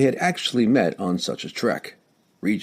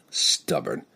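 breathy mumble, half a laugh; mumbled; 6 semitones lower, 3 dB quieter than usual. Acted. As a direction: mutter it fondly. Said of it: "Drizzt mumbled".

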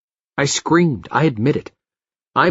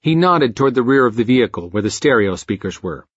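ordinary delivery

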